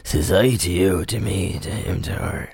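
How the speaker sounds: softly muttered catchphrase, fading away; bread-man catchphrase. Retold or imitated. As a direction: lightly imitate an odd bread man. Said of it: "he's doing this thing".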